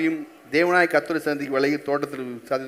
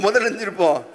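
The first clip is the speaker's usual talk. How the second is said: ordinary delivery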